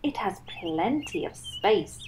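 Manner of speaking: soft whisper. Acted. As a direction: confide it in a whisper. Beaver whispers.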